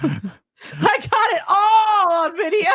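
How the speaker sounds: high, slow, drawn-out crow; gleeful exclamation. Real, not acted. Friend revealing the moment was filmed, high gleeful crow. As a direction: crow it, stretching the words out. Gleeful boast amid laughter.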